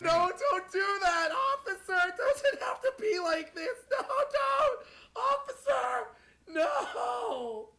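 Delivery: high, wailing shouts for mercy; screaming and crying at the officer from the back seat; panicked crying plea. Acted. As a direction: beg the officer hysterically, half crying. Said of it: "Don't you cry back there".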